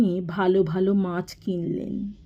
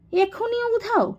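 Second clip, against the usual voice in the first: exasperated disbelief; 14 semitones higher than usual. A narrator's reading. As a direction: voice leaping very high in disbelief. Exasperated hunt for missing shoes. (চড়া গলায় অবাক বিরক্তি)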